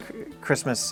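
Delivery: ordinary talk, nothing marked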